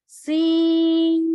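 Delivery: सीन held five counts with hiss; सीन held long; long seen. Shown as a demonstration. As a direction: stretch सीन slowly, whistling s. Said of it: "five fingers तक लंबा जाएगा".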